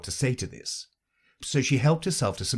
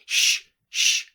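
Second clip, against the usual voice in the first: loud unvoiced shush; 5 dB louder than usual. Hatter and Hare hushing Alice. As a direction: hush him sharply.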